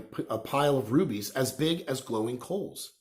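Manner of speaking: plain reading voice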